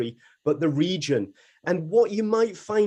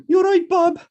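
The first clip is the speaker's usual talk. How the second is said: much higher, louder Brummie accent, hammed up; best Brummie accent; 14 semitones higher, 8 dB louder than usual. Shown as a demonstration. Radio host answering a listener in a loud, high Brummie accent. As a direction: throw out a big, loud Brummie impression. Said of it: "That's my best Brummie accent".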